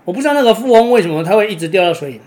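ordinary delivery

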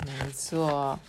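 ordinary delivery

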